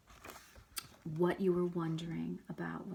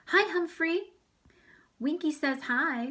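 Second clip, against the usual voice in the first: put-on cheerful child; 9 semitones higher, 7 dB louder than usual. Kids greet Humphrey.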